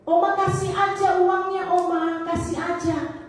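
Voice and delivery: imitating pleading granddaughter. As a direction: beg Oma like a frightened granddaughter. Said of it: "suaranya persis dengan Tresia".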